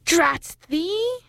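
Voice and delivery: high, spiteful witch cry; yelling witches. A narrator's reading. Witches to their old enemy the moon, high spiteful yell. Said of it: "yelled the witches".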